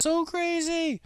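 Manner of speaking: high excited squeal. Real, not acted. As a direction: burst out in disbelief. Excited outburst.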